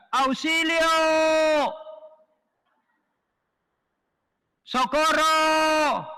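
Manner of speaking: high desperate cry for help, held long; grito de auxilio. Retold or imitated. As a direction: yell for help like a drowning man. Hombre ahogándose grita auxilio. (grito desesperado pidiendo auxilio)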